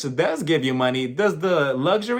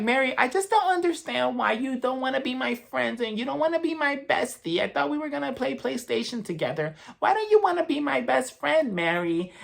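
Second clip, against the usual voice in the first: put-on higher, whiny childish pleading; whiny little baby; 8 semitones higher, 3 dB quieter than usual. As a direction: whine like a clingy kid begging a friend. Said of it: "she was acting like a little baby".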